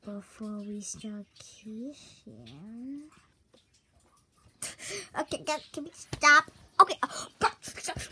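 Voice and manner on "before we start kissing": slow, breathy hush; whisper. Acted. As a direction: whisper it slowly.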